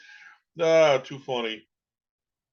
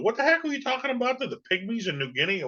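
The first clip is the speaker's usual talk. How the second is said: mock baffled fan; 9 semitones higher than usual. Fans confused after the show.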